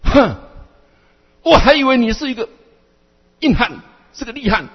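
exasperated teacher's loud scolding; scolding master. Acted. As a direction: berate a hopeless disciple. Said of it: "这样呵斥我了".

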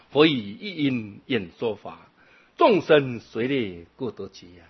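slow, measured chant-like reading of the verse 众生随类各得解; slow sutra recitation. Acted. Monk reciting a sutra line to the assembly, slow chanting. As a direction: intone each syllable evenly and slowly.

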